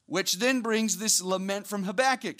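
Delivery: ordinary talk, nothing marked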